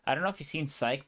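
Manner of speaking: ordinary talk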